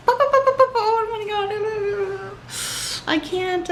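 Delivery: high, slow, squealing oh my God; flustered squeal. Retold or imitated. Acting out how flustered he makes her, high squeal. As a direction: gush like a smitten teen. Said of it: "I'm just so, like, flustered".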